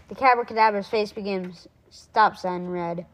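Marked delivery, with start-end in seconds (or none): none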